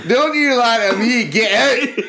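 high, indignant Scottish rebuke; mock Scottish teacher. Scottish teacher sending a pupil out, mock outrage. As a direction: throw a pupil out in a put-on Scottish accent. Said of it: "the Scotsman".

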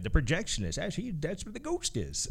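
a bad old man impression; lightly voiced old man. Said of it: "I have one old man voice I'm just gonna use for everything".

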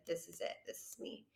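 drops to a soft whisper; whispered self-discovery; hushed realization. Retold or imitated. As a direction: breathe it out like a private vow. Quoting her own realization.

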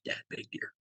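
hushed whisper to dad; retold whisper. Son alerting dad to a deer from the stand, whispering. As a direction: whisper it, barely any voice. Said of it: "I'm obviously whispering".

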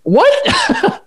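very high, squeaked "what" with a laugh; incredulous. Retold or imitated. Host retelling his reaction to a young-looking fan, incredulous. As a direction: squeal it in comic disbelief.

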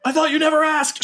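high, laughing flirty answer; playful orc. Acted. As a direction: toss it back high and giddy.

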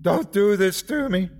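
high-pitched, distraught cry; screaming plea. A narrator's reading. Man with a gun pleading with officer, screaming. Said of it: "he screamed".

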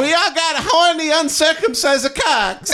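high shouted fake Italian; shouting Italian voice. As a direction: yell it as a horny Italian. Italian begging bit.

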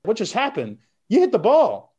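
high, stunned "What just happened?"; amazed disbelief. Retold or imitated. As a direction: sound as if the bat just cracked and you can't believe it. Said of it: "You're like".

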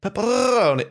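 "Pepperoni" very high, with a trilled R; rolled R. Shown as a demonstration. Copies the rolled R demo.

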